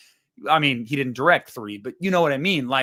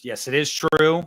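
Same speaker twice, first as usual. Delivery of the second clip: ordinary talk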